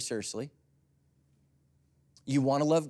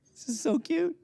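cooing. Acted. Gushing over grandma's purple hair.

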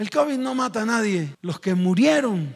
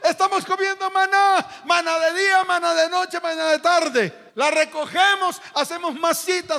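complaining Israelites; 8 semitones higher than usual. Acted. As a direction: whine like bored people fed up. (queja burlona del pueblo aburrido)

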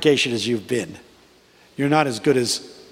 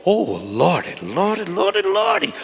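grandmother praising; 6 semitones higher than usual. Retold or imitated. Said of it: "you'll hear them go".

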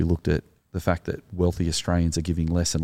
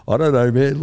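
higher, louder, laughing it off; laughing shrug; 8 semitones higher, 9 dB louder than usual. Retold or imitated. Rescuer shrugs off his heroism, laughing higher. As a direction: toss it away with a laugh. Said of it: "And he's laughing going".

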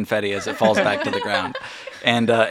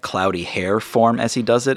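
usual speaking voice

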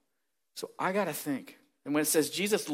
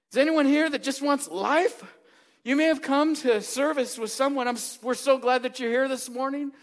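louder, higher fervent preaching; preaching at full force; 5 semitones higher, 6 dB louder than usual. Acted. Pastor urging his church that God loves them, fervent preaching. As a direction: drive it home to the congregation.

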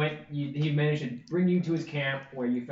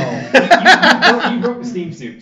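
laughing; 7 semitones higher, 15 dB louder than usual. Real, not acted. DM laughing at kill.

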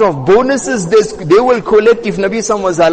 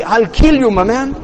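higher-pitched everyday threat; retold threat in street terms, pitched up; colloquial threat; 3 semitones higher than usual. Umar's threat in our terms, colloquial mock threat. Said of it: "In, in our terms".

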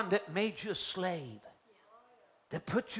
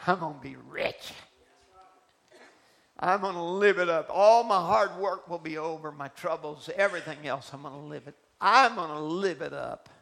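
gold miner dreaming aloud; 11 dB louder than usual. Acted. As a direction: crow about the gold ahead. Miner voiced in sermon story.

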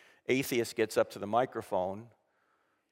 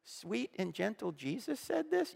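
mock disbelief; 7 semitones higher, 8 dB quieter than usual. Mock disbelief at tough Jesus.

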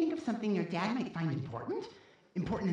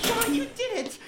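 much higher, louder cry of joy; overjoyed shout; 5 dB louder than usual. Acted. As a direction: yell it the moment the cage opens. Freed from the cage.

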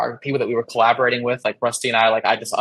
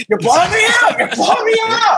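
high, loud panicked yell; shouting; 5 dB louder than usual. Retold or imitated. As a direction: yell it over the noise of the set. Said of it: "you were like".

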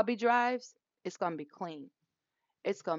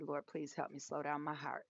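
low, soft plea under the breath; hushed prayer; 5 semitones lower, 12 dB quieter than usual. Retold or imitated. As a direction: quietly beg the Lord for help. Silent plea to God, retold.

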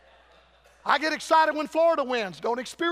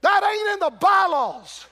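put-on high, loud, cranky old church member shouting; hammed-up stubborn church elder yelling; dry old Baptist; 6 semitones higher, 6 dB louder than usual. Old Baptist yelling up at the roofbreakers, hammed-up outrage. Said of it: "some dry old dead Baptist is looking up saying".

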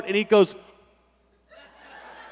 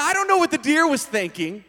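ordinary talk, nothing marked

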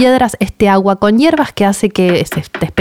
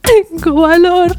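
timid echo; 10 semitones higher, slower than usual. Retold or imitated. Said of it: "Y yo tipo".

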